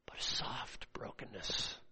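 slow, hushed whisper; soft whisper. Real, not acted. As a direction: whisper it gently and slowly. Whispers "soft brokenness" after loud preaching.

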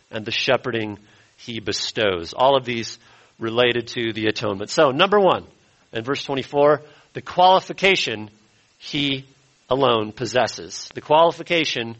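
normal talking voice

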